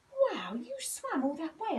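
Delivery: high-pitched, wide-eyed wow; amazed. A narrator's reading. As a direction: gasp it out in awe. Billy amazed.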